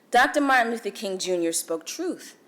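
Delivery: ordinary talk, nothing marked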